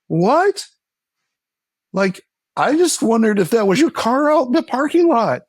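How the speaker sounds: high, incredulous protest; baffled. Retold or imitated. Woman bewildered at a man's eager reaction, sounding incredulous. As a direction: push back, puzzled and put off. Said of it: "And it's like".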